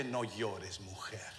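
low, soft disbelieving repeat; incredulous echo. Retold or imitated. Preacher questioning the words to the widow, quietly incredulous. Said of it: "¿Cómo que".

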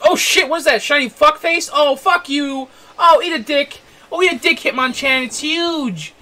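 shocked, high and loud outburst; excited yelling. Real, not acted. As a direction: yell it out in startled excitement. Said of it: "Sorry about the yelling".

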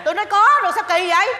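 pitched high and loud, fed up; exasperated insistence. Acted. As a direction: snap back, high and loud, that she already said so. Wife insists friend is coming.

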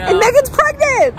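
excited squeal. Real, not acted. Friends gushing over pregnancy news.

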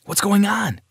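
high, alarmed asking; panicked question. Acted. Johnny panicking at his crying mother. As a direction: blurt it out in sudden fear. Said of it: "I asked in a panic".

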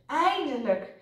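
happy, relieved exclamation; blissful relief. A narrator's reading. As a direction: say it overjoyed, pitch lifted. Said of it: "zuchtte Otje dolgelukkig".

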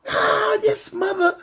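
high outraged woman; angry shriek. Retold or imitated. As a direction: screech it in outrage. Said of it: "she was going crazy".

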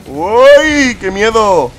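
high squeal of fake fear, hammed up; mock fright. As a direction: chilla de miedo en broma. Mock fright at a spooky map. (susto fingido y exagerado)

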